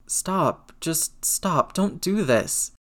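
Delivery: high-pitched, pained begging; distressed plea. A narrator's reading. Upset Peter rejects her sympathy.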